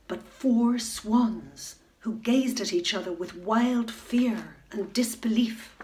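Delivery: ordinary delivery, nothing marked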